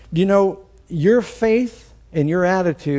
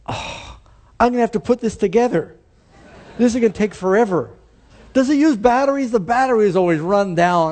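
lightly voiced complaining child, higher and fast; whiny kid; 7 semitones higher, 3 dB louder than usual. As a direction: grumble like a kid who hates his present. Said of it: "and he goes".

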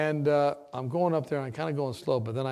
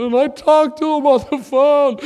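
much higher, louder mock mother voice; mother at potluck; 14 semitones higher, 17 dB louder than usual. Mother telling potluck guests about her son, high mocking voice. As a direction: lampoon the grieving mom telling guests. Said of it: "and she's going".

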